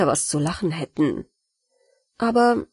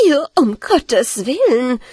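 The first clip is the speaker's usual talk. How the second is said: slow, louder cry of disgust; 4 semitones higher, 4 dB louder than usual. Acted. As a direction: recoil in horror, louder. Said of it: "rief die Hausfrau und ekelte sich sehr".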